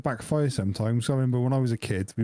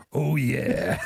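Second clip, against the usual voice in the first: Macho Man impression; 3 semitones higher than usual. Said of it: "Macho Man Randy Savage's thing".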